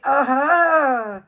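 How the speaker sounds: loud, drawn-out mock groan; mocking her voice. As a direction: stretch it into a loud derisive imitation. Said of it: "so it's like".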